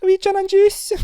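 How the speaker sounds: squeaky mock Scottish voice; put-on Scottish thanks, pitched very high; high-pitched Scottish accent. Thanking friend for a compliment, high-pitched Scottish accent. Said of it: "doing that in a high-pitched voice".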